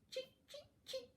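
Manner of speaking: very high, soft squeaky cheep; chick cheep. Acted. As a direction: peep like a tiny chick. Chick sound in picture book.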